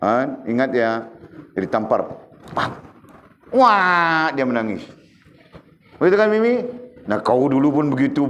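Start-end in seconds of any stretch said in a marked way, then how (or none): 3.5-4.3 higher, louder imitation of a newborn's cry; mock baby wail. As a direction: wail like a baby just slapped. Imitates slapped newborn crying. (tiruan tangisan bayi, tinggi dan kuat)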